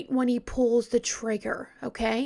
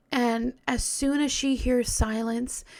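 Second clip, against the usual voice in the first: normal talking voice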